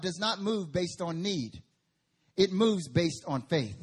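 slow, stressed delivery with faith hit loud; emphatic preaching. Real, not acted. Pastor hammering the point to the church, slow and stressed. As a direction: lean on each word. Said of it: "slow emphatic preaching".

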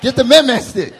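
loud, high call for the man man stick; mock parent shout. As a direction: bellow it like a parent reaching for the switch. Parent calling for the switch.

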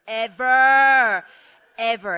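"ever" loud and drawn out, then repeated; loud, lingering stress on "ever"; emphatic exaggeration. Real, not acted. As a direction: stretch the first "ever" for comic effect. Exaggerates the slowest elevator.

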